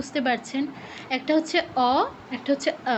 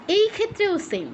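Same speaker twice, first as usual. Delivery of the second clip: normal talking voice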